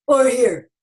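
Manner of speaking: changed resonance at the same pitch; shifted-placement version of the same words; different placement. Shown as a demonstration. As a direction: move the sound to a new spot in the mouth. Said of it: "Find out what's it sound like when your voice is here".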